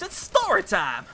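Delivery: slow, drawn-out story intro; hammed-up announcement. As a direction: announce it with showy relish. Hypes up the story being read.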